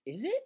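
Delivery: "Is it" pitched very high, rising; questioning rise. Shown as a demonstration. Teacher showing learners the habit to drop, questioning rise. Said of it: "you have to get rid of this".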